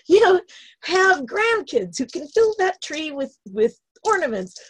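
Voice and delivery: high, emphatic telling-off; mock-stern pep talk; acted self-scolding. Acting out scolding herself.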